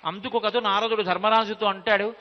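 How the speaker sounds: ordinary talk, nothing marked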